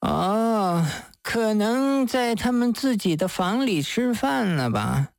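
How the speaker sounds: put-on slurred, rambling drunken voice with hiccups; tipsy gossip. Drunk man griping to the judge about the priests, tipsy. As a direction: ramble boozily, dragging the words.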